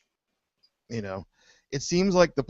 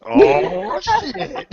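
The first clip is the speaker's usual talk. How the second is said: high squealing oh shit; shocked laugh; 8 dB louder than usual. Real, not acted.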